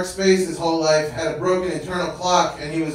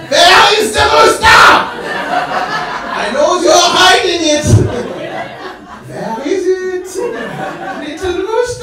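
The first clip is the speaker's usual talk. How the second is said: high, loud, hammed-up villain shouting; movie villain inspector; 10 dB louder, slower than usual. City inspector searching the apartment, hammed up as a high, shouting movie villain. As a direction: play the inspector as a menacing movie villain hunting the rooster. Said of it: "it felt like that scene from Inglourious Basterds".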